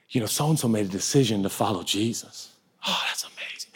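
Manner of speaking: hushed whisper; whispered gossip. Retold or imitated. Churchgoers passing news, in a whisper. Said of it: "We're like".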